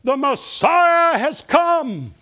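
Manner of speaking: high, loud, slow herald's cry; joyful proclamation. Acted. Messenger from the tomb proclaiming to the city, high, loud and slow. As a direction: announce it like a herald at the gate. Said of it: "one of the men says".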